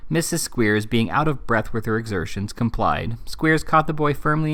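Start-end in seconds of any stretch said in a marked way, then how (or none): none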